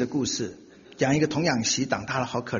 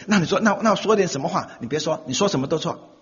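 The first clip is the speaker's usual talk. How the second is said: ordinary delivery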